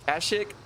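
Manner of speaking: Kashyyyk as KASH-ick, a as in cash; flat-a pronunciation. Shown as a demonstration. Unsure streamer to viewers, Kashyyyk said KASH-ick. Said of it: "not knowing how to say".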